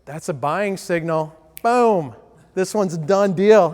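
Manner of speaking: loud, eager boom of a salesman sure of the sale; happy ears. Retold or imitated. Salesman with happy ears, loud and thrilled. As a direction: punch out Boom like the deal is closed. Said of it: "we get what I call happy ears".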